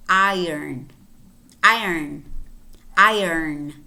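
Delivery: iron as EYE-urn, R after the vowel; moved R. Shown as a demonstration. Teacher explains where R moves, iron as EYE-urn. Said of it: "but it moves. And then you say".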